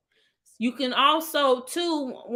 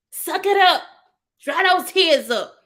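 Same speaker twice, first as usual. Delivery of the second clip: loud, high scolding command; stern parent; 7 semitones higher, 5 dB louder than usual. Retold or imitated. Parent telling crying child to toughen up, loud stern order. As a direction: order it sharply like a fed-up parent.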